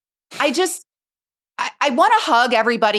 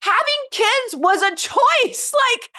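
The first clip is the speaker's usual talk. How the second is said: high, slow, laughing disbelief; incredulous laugh; 13 semitones higher, slower than usual. Real, not acted. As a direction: laugh it off, much higher. Laughing at parents' complaint.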